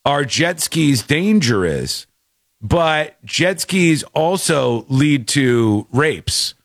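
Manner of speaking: slow, deliberate paraphrase of an official warning; mock ambassador statement. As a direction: spell it out slowly, mimicking a solemn announcement. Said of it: "he was like".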